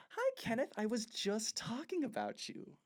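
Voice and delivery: high, sugary fake-sweet greeting. [name] greets Kenneth sweetly.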